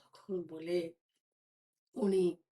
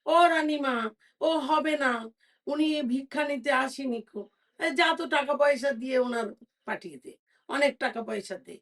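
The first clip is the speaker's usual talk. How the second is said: raised, eager maid's call to the queen; 5 semitones higher, 5 dB louder than usual. Acted. Maid urges the queen to send the beggar off, raised voice. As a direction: call out to the queen, a bit hurried.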